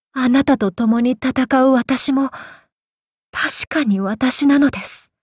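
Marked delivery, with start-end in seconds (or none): none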